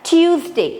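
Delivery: Tuesday said clearly as TYOOZ-day; teacher's model Tuesday; careful Tuesday. Shown as a demonstration. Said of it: "the pronunciation as".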